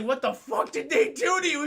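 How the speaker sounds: hammed-up horrified cry; mock anguished yell. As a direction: shout it in horror at your changed friend. Horrified friend in scene.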